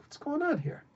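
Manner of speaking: high-pitched and puzzled; high baffled voice. Retold or imitated. King puzzled by the secret passage, high baffled voice. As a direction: pitch it up, bewildered.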